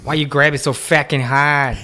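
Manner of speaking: slow, hammed-up Boston accent; bad Boston accent. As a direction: drag it out in mock Boston. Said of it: "that's a bad accent".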